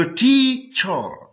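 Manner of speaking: Teacher very slowly in two parts, टी, चर; syllable by syllable. Shown as a demonstration. Teacher showing learners the two syllables, teacher slowly split as टी-चर. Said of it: "टी एक syllable है और चर दूसरा".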